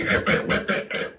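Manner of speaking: rapid throaty chomping noises; unvoiced crunching and guzzling sound; munching locusts. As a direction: imitate greedy insects gobbling. Imitating locusts eating everything.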